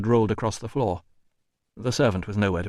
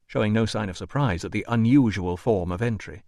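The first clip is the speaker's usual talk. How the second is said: usual speaking voice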